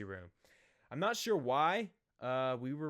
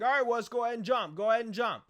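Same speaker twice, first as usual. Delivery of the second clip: higher, louder imitation of an egging-on brother; urging; 8 semitones higher, 7 dB louder than usual. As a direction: call out as if egging someone on. Brother urging him to jump.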